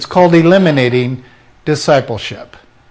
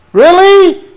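sharp high "Really?"; preacher scoffing at Peter's pride, high and incredulous; 13 semitones higher, 5 dB louder than usual. Real, not acted. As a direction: toss out the disbelief. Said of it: "rhetorical disbelief".

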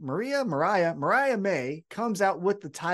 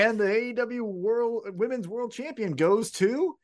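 award announcer; 5 semitones higher than usual. Retold or imitated.

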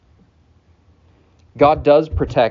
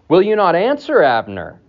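calling out; 5 semitones higher, 4 dB louder than usual. Acted. David calls across to the army.

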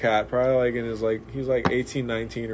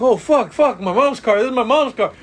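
high, loud mock panic; panicked outburst; 9 semitones higher, 6 dB louder than usual. Driver sees his mom's wrecked car, panicked outburst. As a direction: wail it like you just wrecked the family car.

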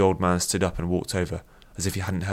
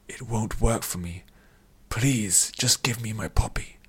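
higher, breathy, whispered plea; pleading; 3 semitones higher, slower than usual. A narrator's reading. Addict begs the old man for poppy, pleading weakly. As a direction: beg weakly, slipping into a whisper.

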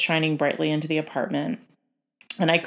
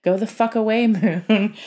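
raised, higher voice breaking into a laugh; mock cursing at the moon; 6 semitones higher, 7 dB louder than usual. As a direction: curse the moon, then laugh at yourself. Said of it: "telling the moon to fucking good night itself".

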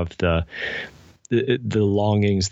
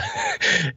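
short laugh. Real, not acted. Laughing at her younger self.

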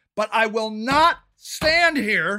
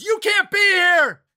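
high, slow mock shout; imitated yell; 6 semitones higher, 3 dB louder than usual. HOA president ordering canvasser out, mock yelling. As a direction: holler it like an indignant association president. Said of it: "I got yelled at".

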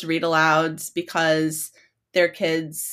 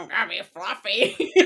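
little girls imitating Fluffy; 3 dB quieter than usual. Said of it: "try to whip out that voice themselves".

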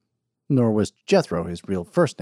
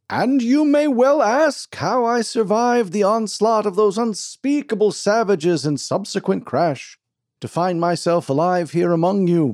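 pompous snake-oil salesman; 9 semitones higher, 5 dB louder than usual. Acted. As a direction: sell the crowd a tall tale like a showman.

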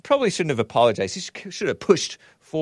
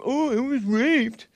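high, slow, put-on whiny woman's voice; sarcastic falsetto impression of a woman; mocking a woman; 13 semitones higher, slower than usual. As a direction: mimic her mockingly, way up high. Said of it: "women claim".

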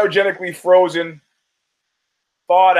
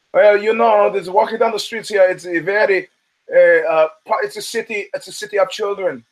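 put-on German accent; 4 semitones higher than usual. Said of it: "in his German accent".

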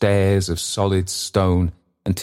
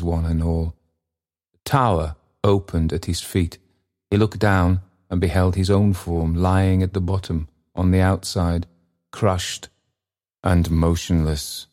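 ordinary delivery

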